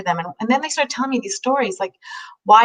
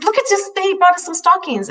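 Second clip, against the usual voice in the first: higher, a touch of a cheerful shelter lady; lightly voiced delighted woman; 9 semitones higher than usual. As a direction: announce it to the room, a bit higher. Shelter woman announces to tables.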